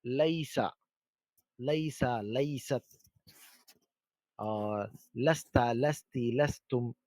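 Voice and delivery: slow, fading list of forms; slow conjugation listing. Shown as a demonstration. Teacher listing the forms of लईसा for students. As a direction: recite each form of लईसा slowly and softly. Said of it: "फुसहा में".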